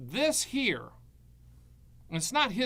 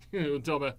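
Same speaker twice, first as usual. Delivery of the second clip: ordinary delivery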